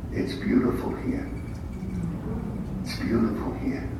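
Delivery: hushed and soft, half breathed; soft voice from beyond. Retold or imitated. Wife's voice in his head, hushed and soft. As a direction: let it float in gently, barely voiced.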